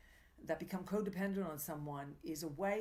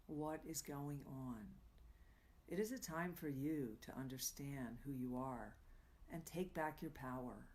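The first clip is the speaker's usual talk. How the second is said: guided meditation; 5 dB quieter than usual. Acted. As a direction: hush the voice and lead listeners gently inward. Leads a mindful moment.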